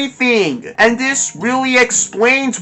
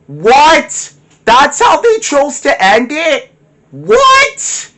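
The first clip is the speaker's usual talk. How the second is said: incredulous shout, pitch leaping way up; can't believe that's the ending; stunned disbelief; 6 semitones higher, 4 dB louder, slower than usual. Real, not acted.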